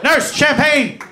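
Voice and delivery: loud, high and thrilled, greeting a rich patient; excited dentist voice. Retold or imitated. Dentist thrilled to see a patient with bad gums, loud and gleeful. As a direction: cheer like he just hit the jackpot.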